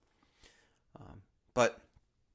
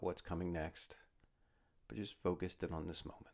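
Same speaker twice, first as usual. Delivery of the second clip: guided meditation; 2 semitones lower, 7 dB quieter than usual. Acted. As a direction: ease them down into stillness, word by word. Leads a body scan meditation.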